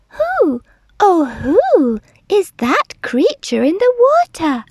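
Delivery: high, hooty questioning voice; high little-dinosaur wondering; wondering Drip. A narrator's reading. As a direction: hoot the question up high, swooping. Curious Drip asks the herd.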